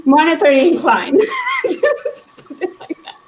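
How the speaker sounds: breaking into laughter mid-phrase, pitch jumping up; laughing. Real, not acted. To a fellow reader, laughing at her own euphemism for greed. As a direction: giggle through the words, unable to keep a straight face.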